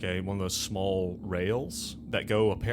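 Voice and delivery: usual speaking voice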